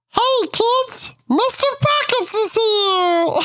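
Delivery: very high, squeaky clown greeting; Mister Pockets, clownish entertainer. Acted. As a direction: announce yourself to the kids like a cartoon clown. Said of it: "he looks at everyone and goes".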